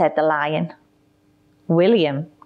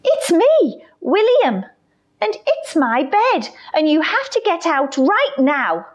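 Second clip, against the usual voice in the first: put-on bossy child voice; 11 semitones higher than usual. William orders lion out.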